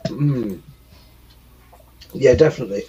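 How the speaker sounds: talking while chewing; mouth full. Real, not acted. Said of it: "I was just chewing something".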